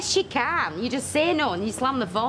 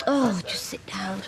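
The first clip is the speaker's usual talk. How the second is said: whispering; 2 semitones lower, slower than usual. Acted. Irritated at friend checking fever.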